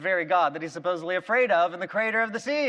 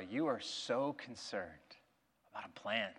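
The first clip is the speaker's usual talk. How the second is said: much softer and lower, gently chiding; quiet rebuke; 10 semitones lower, 14 dB quieter than usual. Retold or imitated. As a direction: drop to a hush, tender correction. God gently rebukes Jonah.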